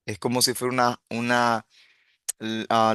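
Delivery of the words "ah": normal talking voice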